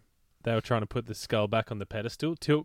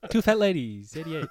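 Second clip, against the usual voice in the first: bingo caller; 6 semitones higher than usual. Pretend. Bingo calls after "play bingo.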